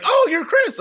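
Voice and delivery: squeaky high retold gasp of recognition; a fan's loud high surprised squeal of recognition; high excited realization. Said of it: "He was like".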